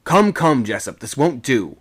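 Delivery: brisk officer's telling-off; sharp reprimand. A narrator's reading. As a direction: rebuke a sailor sharply. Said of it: "he said, sharply".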